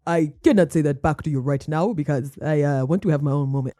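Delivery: mock him in a deep dopey voice; deep-voiced mocking of the boyfriend.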